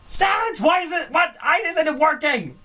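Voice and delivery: high frantic shouting skeleton; Papyrus voice. Retold or imitated. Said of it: "he's like".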